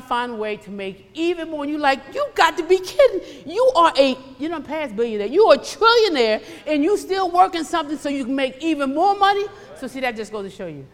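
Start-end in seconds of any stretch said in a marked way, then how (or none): 2.1-9.5 high, loud mock disbelief; incredulous. Preacher addressing an imagined trillionaire still chasing money, loudly incredulous. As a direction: exclaim in exaggerated astonishment.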